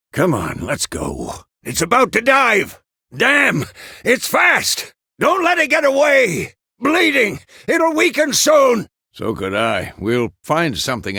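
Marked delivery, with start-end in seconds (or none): 1.7-8.9 very high, loud combat yells; urgent battle shouting. Acted. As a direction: shout warnings to a hunting partner. Calling out while fighting a beast.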